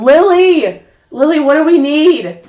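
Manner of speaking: loud high call into the house. Acted. Mother calling to Lily inside the noisy house, loud. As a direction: shout for her across the house.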